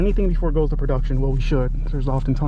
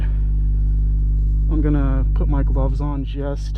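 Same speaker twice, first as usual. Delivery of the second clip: talking through a closed helmet; muffled, mouth behind a helmet visor; 6 dB louder than usual. Real, not acted. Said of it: "especially since I'm speaking with a helmet".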